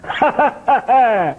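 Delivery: high theatrical belly laugh; booming staccato ha-ha-ha, pitched high. Acted. Mimic opening a singer's comic song, theatrical laugh. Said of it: "[name] voice వచ్చింది".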